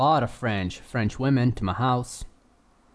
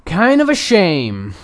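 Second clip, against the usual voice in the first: loud, drawn-out, higher mock lament; exaggerated lament; 5 semitones higher, 8 dB louder, slower than usual. Repeating his regret to the co-host, hammed-up lament. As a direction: stretch it out slowly and loudly.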